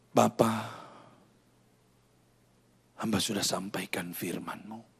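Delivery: soft, low prayer sinking into a whisper; hushed prayer. Real, not acted. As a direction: pray gently over bowed heads. Leads closing prayer. (doa lembut yang berbisik)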